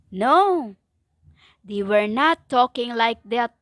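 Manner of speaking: usual speaking voice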